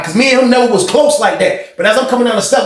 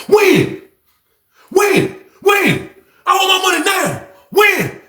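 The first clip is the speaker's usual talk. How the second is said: furious shout; 13 semitones higher, much slower than usual. Retold or imitated. Knife-wielding inmate demanding money.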